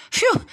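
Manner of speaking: high sighing phew of relief; dog glad the danger passed; relieved phew. A narrator's reading. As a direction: blow out in relief. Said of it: "said the dog".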